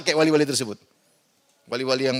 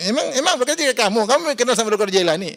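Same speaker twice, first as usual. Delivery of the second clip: higher, scoffing challenge; mocking disbelief; 7 semitones higher, 4 dB louder than usual. Preacher mocks the worshippers with a scoffing, higher challenge. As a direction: toss the question back with a scoff.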